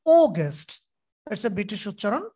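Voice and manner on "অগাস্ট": অগাস্ট with the first syllable ও loud; stress on ও. Shown as a demonstration. Teacher shows stress on first syllable of অগাস্ট. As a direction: hit the ও of অগাস্ট. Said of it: "এখানে ও তে জোর হবে".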